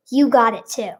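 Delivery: ordinary talk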